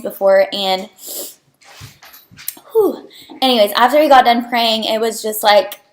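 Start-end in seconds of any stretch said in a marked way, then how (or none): none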